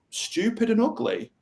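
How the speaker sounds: high, surprised echo of their words; gentle and puzzled, asking where it came from; curious mirroring. Shown as a demonstration. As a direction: reflect it back with open curiosity. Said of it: "just hold the mirror back up".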